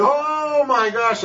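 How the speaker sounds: high, stretched-out startled oh; retold shock. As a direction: draw the oh out high in mock alarm. His startled reaction to the scream.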